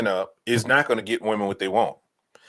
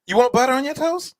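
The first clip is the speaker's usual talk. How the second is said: eager fawning questions, pitched up a little; smitten admirer; 6 semitones higher than usual. Acted. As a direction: play someone falling over themselves to please. Acts out eager toast-serving admirer.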